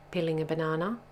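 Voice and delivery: usual speaking voice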